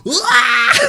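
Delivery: very high, loud wail; imitated baby scream. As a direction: shriek like a bawling baby. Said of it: "B B's like".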